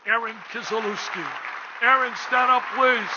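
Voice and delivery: loud, ringing call to the crowd; booming introduction. Real, not acted. Host calling Erin up before the gala crowd, booming announcement. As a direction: proclaim her name so the whole room hears.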